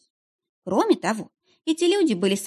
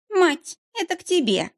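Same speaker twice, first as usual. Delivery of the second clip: lazy call; 10 semitones higher than usual. A narrator's reading. Teen girl calling her mother from the doorway, lazy shout. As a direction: call it back over your shoulder, bored. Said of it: "лениво крикнув в глубину квартиры".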